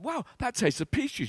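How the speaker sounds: amazed. Retold or imitated. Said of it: "I said".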